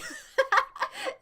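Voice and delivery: high squealing giggle. Real, not acted. Streamer giggling at a viewer's name. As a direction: giggle up high in spite of yourself.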